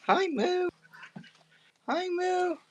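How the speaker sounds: very high, sing-song pet voice. Real, not acted. Owner greeting her dog Moo in squeaky baby talk. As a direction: coo it way up high at the puppy.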